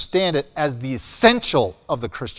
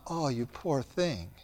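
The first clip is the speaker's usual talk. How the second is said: slow, soft, pitying "Oh, you poor thing"; mock pity; 13 dB quieter than usual. Pastor mocking hollow sympathy from church people, slow soft mock pity. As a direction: drip fake sympathy, slowly and quietly.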